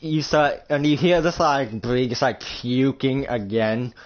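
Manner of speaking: ordinary delivery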